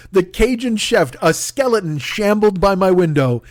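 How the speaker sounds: usual speaking voice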